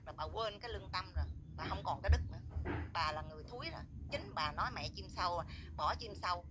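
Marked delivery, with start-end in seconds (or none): none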